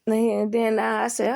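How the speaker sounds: ordinary talk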